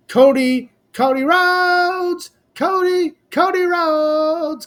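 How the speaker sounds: slow, soaring hype shout of the returning star's name; hyped ring announcer call. Real, not acted. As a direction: call it out like the crowd just popped for him. Calling out Cody Rhodes' return.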